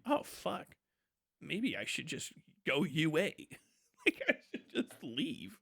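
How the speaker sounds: hushed, low musing with a stifled laugh; mock dawning doubt. Soldier watching the war film, hushed mock misgiving. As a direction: play a soldier softly second-guessing himself. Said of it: "And I wasn't like".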